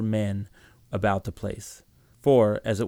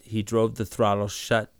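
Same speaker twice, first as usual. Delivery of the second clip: ordinary delivery, nothing marked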